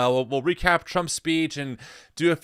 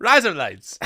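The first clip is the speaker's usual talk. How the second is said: louder go at Australian "razor blades"; words blended into playful Australian; Australian accent; 2 semitones higher, 5 dB louder than usual. Shown as a demonstration. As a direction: relish the Australian-sounding trick. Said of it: "in an Australian accent".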